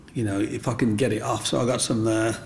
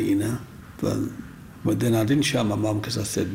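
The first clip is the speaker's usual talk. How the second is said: ordinary delivery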